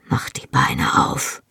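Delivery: low, slow intimate whisper. A narrator's reading. Husband to wife in bed at night, whispered. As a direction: whisper it slowly, for the lover's ear only. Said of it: "flüsterte er".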